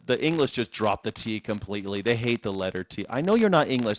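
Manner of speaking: ordinary talk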